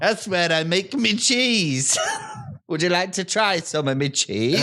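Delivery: put-on a suspicious farmer in an Irish-sounding accent, breaking into laughter. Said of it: "I've got the accent".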